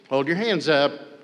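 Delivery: usual speaking voice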